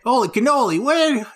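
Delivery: high startled exclamation; shocked. Acted. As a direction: yelp it out in shock. Shocked at a dinosaur.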